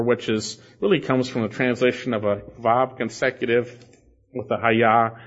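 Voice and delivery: normal talking voice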